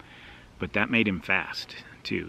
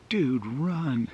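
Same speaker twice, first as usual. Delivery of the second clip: urgent yell; 7 semitones higher, 4 dB louder than usual. Retold or imitated. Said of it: "just yelled".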